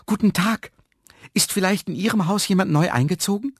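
high, eager question to a stranger; delighted greeting. A narrator's reading. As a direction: greet them loudly, full of hope. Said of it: "lief erfreut auf sie zu". (erfreut und mit hoher Stimme)

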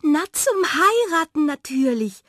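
high, loud and elated; excited announcement. Acted. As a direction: announce it as utterly obvious. Miranda announces her wedding plan. (hoch und laut, begeistert)

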